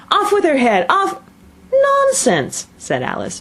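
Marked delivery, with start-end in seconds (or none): 0.1-1.2 high-pitched furious order; screamed. A narrator's reading. Furious Queen orders Alice's execution, screaming. 1.7-2.7 very high, emphatic dismissal; loud and decided. A narrator's reading. Alice defies the Queen, loudly and decidedly. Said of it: "very loudly and decidedly".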